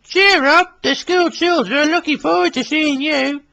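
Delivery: Billy voice. Acted. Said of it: "smiled Billy".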